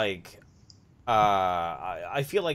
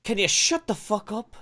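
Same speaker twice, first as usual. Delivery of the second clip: higher, blunt mock retort; blunt Irish reply; 7 semitones higher than usual. Host contrasting Irish reply with posh one, blunt. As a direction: imitate a blunt Irish comeback, rising at the end. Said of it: "But the Irish one would be like".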